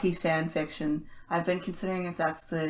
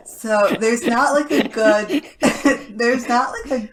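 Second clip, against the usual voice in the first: laughing; 5 semitones higher, 9 dB louder than usual. Real, not acted. Laughing about bad taste verdict.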